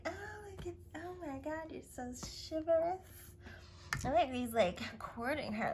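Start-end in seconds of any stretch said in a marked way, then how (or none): 0.1-3.0 high, breathless swooning; swooning woman. Retold or imitated. Woman answering the prince, high and swooning. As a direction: gush at his chivalry, starry-eyed. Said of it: "And she's like".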